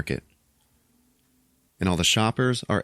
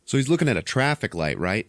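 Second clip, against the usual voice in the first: ordinary talk, nothing marked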